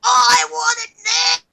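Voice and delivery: put-on slow, high, wailing tantrum yell like a spoiled child; tantrum shout. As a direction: scream it out slowly like a toddler losing it. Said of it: "And I start".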